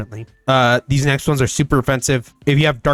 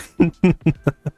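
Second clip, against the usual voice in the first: amused chuckle; 4 semitones higher than usual. Real, not acted. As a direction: run of quick chuckles. Amused by the nickname.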